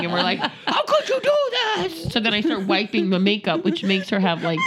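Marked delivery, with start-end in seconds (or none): 0.7-2.1 high wailed parental reproach, half laughing; mock dismayed scolding. Parents reproaching crying daughter, mock wail.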